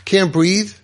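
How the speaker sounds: normal talking voice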